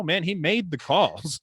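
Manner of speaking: normal talking voice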